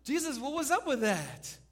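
high, loud, baffled protest to Jesus; puzzled complaint. Acted. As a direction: question Jesus like a confused disciple. Preacher voices puzzled disciples' complaint.

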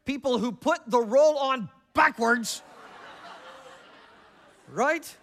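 loud, high and slow, hammed-up disgust; mock outrage. Real, not acted. Preacher teasing a churchgoer about backwards rolls, mock indignation. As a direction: drag it out as if it were a true scandal. Joking about toilet roll peeves.

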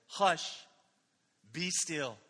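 steady, firm command without anger; calm authority, no shouting; firm but calm. Shown as a demonstration. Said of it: "he spoke firmly but calmly".

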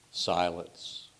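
ordinary talk, nothing marked

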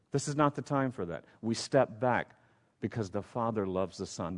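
ordinary talk, nothing marked

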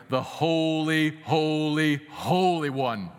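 loud, drawn-out, awe-struck proclamation; put-on grand aura. Shown as a demonstration. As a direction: make each HOLY boom, long and solemn. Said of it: "You can say it like that".

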